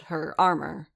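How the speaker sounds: ordinary delivery, nothing marked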